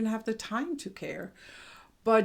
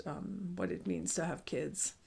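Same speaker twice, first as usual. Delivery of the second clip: ordinary delivery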